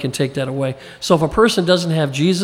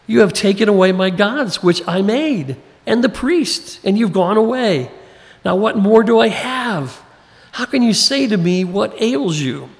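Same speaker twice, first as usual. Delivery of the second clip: higher, pleading lament, slowing near the end; a hint of a robbed man crying out to the thieves; distressed Micah; 4 semitones higher than usual. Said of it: "can you imagine how sad it sounds".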